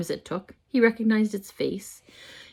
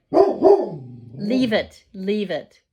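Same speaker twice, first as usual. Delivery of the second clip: louder "leave it" to the dog; firm loud command; 3 semitones higher, 5 dB louder, much slower than usual. Real, not acted. Owner ordering her dog to leave it, loud and firm.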